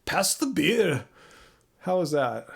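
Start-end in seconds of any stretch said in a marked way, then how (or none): 0.1-1.0 hammed-up Scottish accent; Scottish-Irish accent. Shown as a demonstration. Streamer showing off a shaky-at-best accent for viewers, Scottish-Irish. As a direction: try a swooping Scottish-Irish brogue. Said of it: "more Scottish or Ir".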